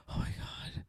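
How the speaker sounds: breathless terrified whisper; mock scared whisper. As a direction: gasp it out as if something is at the door. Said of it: "He starts acting all scared".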